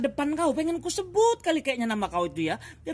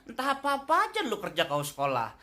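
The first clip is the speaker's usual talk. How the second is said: normal talking voice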